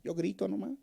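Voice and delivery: high, soft shrug of a reply; clueless marcher. Retold or imitated. Protester answering a journalist, high careless shrug. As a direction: answer like someone who doesn't know why. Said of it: "les preguntan y dicen".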